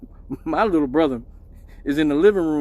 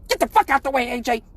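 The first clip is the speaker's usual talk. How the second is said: mom snapping; 6 semitones higher, faster than usual. Retold or imitated. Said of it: "And she goes".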